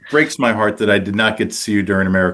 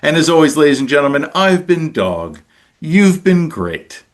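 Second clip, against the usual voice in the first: announcer sign-off; 3 semitones higher than usual. Acted. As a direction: sign off like a big show announcer. Podcast host's show sign-off.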